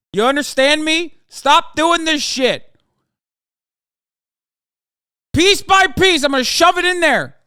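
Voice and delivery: furious rant. Acted. Streamer yelling at fans who keep sending clips, building to a furious scream.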